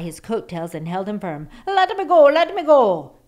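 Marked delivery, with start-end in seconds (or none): 1.7-3.2 high, loud shrieking creature cry; shouting leprechaun. A narrator's reading. Caught leprechaun shouting to be released, high and loud. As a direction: squeal it like a trapped little man. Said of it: "the leprechaun shouted".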